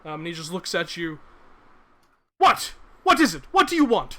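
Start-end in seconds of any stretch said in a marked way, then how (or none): 2.4-4.2 high, loud, impatient demands through the door; irritated high shout. Acted. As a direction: bark it at an unwelcome visitor. Injured man snaps at visitor.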